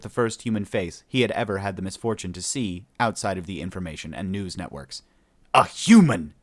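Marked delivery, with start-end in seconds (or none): 5.5-6.3 loud angry growl, pitched up. A narrator's reading. Shipmaster confronts the first human he's seen, growling angrily. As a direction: snarl out the word in disgust. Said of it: "he growled angrily".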